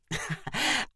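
a short amused laugh. A narrator's reading. Tanner laughing off his brother's prank, amused laugh. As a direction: laugh it off lightly. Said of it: "Tanner laughed again and said".

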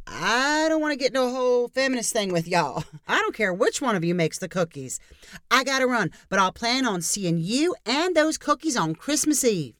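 pushy neighbor. Acted. Diane backing away.

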